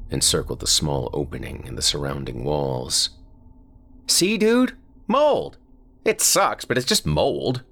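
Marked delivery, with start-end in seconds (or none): 4.1-7.6 put-on high, loud boy proving a point; told-you-so kid. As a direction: shout it's just mold. Friend proving his point.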